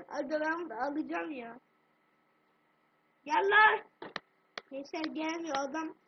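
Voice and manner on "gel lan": loud urgent call; shout. Real, not acted. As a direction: yell for them to come. Calling out in game.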